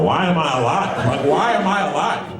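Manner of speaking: high-pitched, rising exasperation; exasperated complaint. Acted. Comic questions his own survival, in high exasperated protest.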